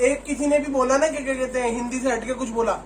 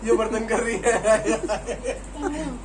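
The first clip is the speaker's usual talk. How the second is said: laughing; 2 semitones lower, much slower than usual. Real, not acted. Mocking sister's phrase.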